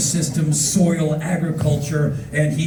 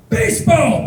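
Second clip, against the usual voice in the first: high, loud one-word toast; excited; 11 semitones higher, 5 dB louder than usual. Retold or imitated. Bus driver shakes hands with tourist, loudly excited. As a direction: proclaim it with mock gusto.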